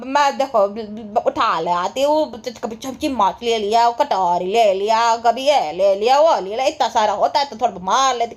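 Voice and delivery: low, slow drawn-out impression of a petty pilferer; mock confession. Imitating the relatives owning up to lifting things from restaurants, mock confession.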